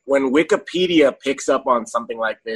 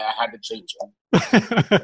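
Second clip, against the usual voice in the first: usual speaking voice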